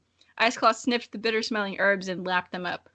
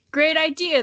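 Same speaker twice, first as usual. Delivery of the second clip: higher, louder cheerful exclaim; 7 semitones higher, 5 dB louder than usual. Acted. Misty Dragon praises the plan, higher and louder exclaiming. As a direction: cheer the idea on. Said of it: "the Misty Dragon exclaimed".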